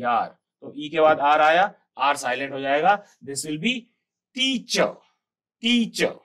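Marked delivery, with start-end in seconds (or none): 4.3-6.1 teacher said high as tee-chuh, no r sound; teacher modelled as tee-chuh for pupils; teacher without r. Shown as a demonstration. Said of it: "तो आर नहीं, टर नहीं चलेगा".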